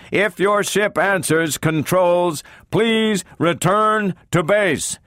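slow, high-pitched repetitive announcer call; stentorian mechanical voice. A narrator's reading. Unseen voice calls two lost crewmen to report, stentorian and mechanical. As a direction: intone it mechanically, each name at the same high pitch. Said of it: "stentorian and petrifying in its effect".

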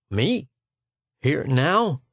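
lightly acted panicked questions; startled, rising pitch of alarm; sudden panic. A narrator's reading. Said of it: "in sudden panic".

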